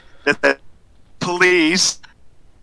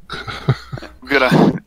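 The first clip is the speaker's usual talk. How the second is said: ordinary delivery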